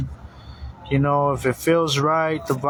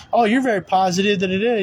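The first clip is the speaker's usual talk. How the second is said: higher, chatty retold compliment; breezy mock pleasantry; lightly acted friendly chat; upbeat small talk; 7 semitones higher than usual. Voices own upbeat small talk.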